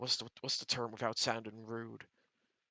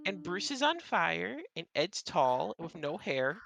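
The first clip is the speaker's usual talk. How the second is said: higher and slow, each word drawn out; 6 semitones higher, 4 dB louder, slower than usual. Acted. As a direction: spell it out like to a child. GM voice describing party.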